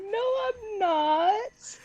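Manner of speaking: high, drawn-out wail of mock indignation; whiny protest. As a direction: protest like a teased little kid, stretching the words. Acting out childhood protest at teasing.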